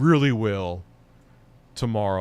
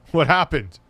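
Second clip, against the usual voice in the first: louder insistent; 6 semitones higher, 7 dB louder than usual. Real, not acted. Repeats louder.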